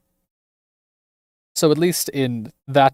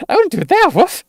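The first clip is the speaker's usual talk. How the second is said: much higher and louder, a yelped no; loud dog girl refusal; 7 dB louder than usual. Acted. Dog girl refuses being teased.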